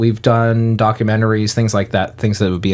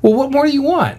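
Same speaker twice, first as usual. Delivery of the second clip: much higher-pitched protest; mock indignant. Real, not acted. Host defends his short intro, high and indignant.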